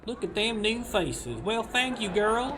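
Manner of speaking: high-pitched mock Southern drawl; southern accent. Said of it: "that was my southern accent".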